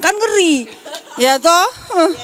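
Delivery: laughs the words out, voice jumping high; amused laugh breaking through. Real, not acted. Churchgoer laughing as she answers the pastor's quiz. Joking about Korea Utara.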